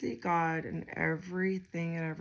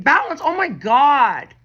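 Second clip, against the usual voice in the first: much louder, higher amazed cry; real surprise; 7 semitones higher, 15 dB louder than usual. Real, not acted. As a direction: blurt it out, stunned. Card matches what she just said.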